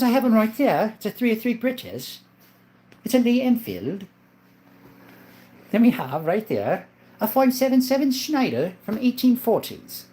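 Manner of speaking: high-pitched put-on British accent. Showing a viewer the British rifles, British accent.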